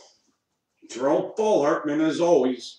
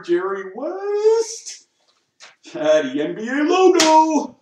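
excited high; 13 semitones higher, 9 dB louder than usual. Real, not acted. Excited discovery.

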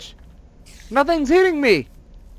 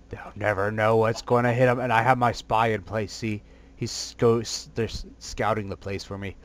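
deep scheming villain. Acted. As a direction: growl out the plan like a cartoon baddie. Deep voice plotting sneak attack.

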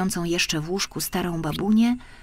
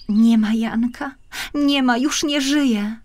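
put-on high, slow, plaintive child's cry; a small girl wailing for her brother; little girl calling out; 7 semitones higher, 4 dB louder, slower than usual. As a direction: call out like a lost little girl grieving. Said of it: "wołała mała Marysia".

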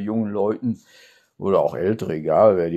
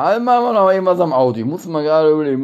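excited; 7 semitones higher, 5 dB louder, faster than usual. Real, not acted. Excited host bit about cars.

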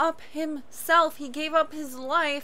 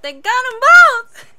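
high, loud exclamation; excited shout; 5 semitones higher, 13 dB louder than usual. Real, not acted. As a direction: shout it out in amazement. Repeats it in excitement.

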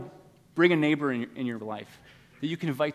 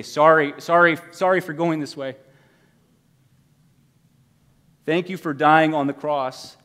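pleading prayer; 2 semitones higher, 6 dB louder than usual. Pretend. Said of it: "You just need to say".

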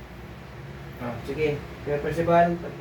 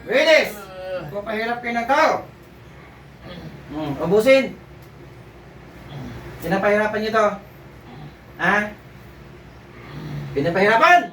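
commanding exorcism; 3 semitones higher than usual. Acted. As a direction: order the spirit out, rising in force. Healer commanding spirits to leave.

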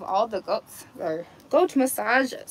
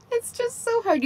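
high whiny repeat of so hard; mock whine; 11 semitones higher than usual. Real, not acted.

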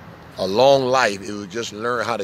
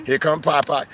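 ordinary talk

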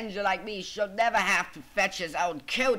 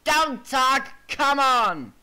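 loud, higher, bossy order; impatient shout; 5 semitones higher, 7 dB louder, much slower than usual. Acted. James answering grumbling coaches, impatient shout. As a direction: shout down the back talk.

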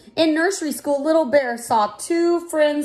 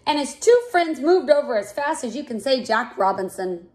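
ordinary delivery

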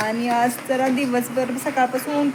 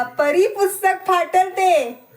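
slow, higher teasing scold; mock scolding; 7 semitones higher, 4 dB louder, slower than usual. Mother teasing daughter about tearing the book, mock scold. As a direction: playfully tell her off.

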